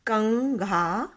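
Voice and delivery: कंघा said very slowly with the nasal clear; teacher's slow example word. Shown as a demonstration. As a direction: draw out कंघा for the pupils. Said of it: "जैसे".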